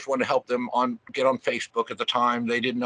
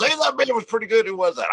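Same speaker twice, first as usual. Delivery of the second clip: high, bright imitation of impressed festival-goers; impressed crowd voice; 11 semitones higher, 5 dB louder than usual. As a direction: play the surprised fan who just discovered a great band. Festival crowd praising the band.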